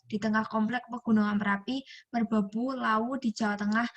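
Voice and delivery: usual speaking voice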